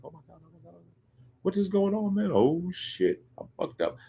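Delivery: soft, high-pitched mock dismay; hushed panic. Whitney realizing who is on the phone, hushed high panic. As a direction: play her fretting under her breath.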